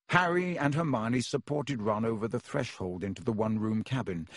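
ordinary delivery, nothing marked

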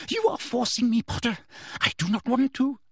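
high, agitated woman's voice; restless official. A narrator's reading. Teacher blames pupil for her coming cruelty, agitated. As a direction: protest reluctance in a high, tense voice. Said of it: "still moving restlessly on the spot".

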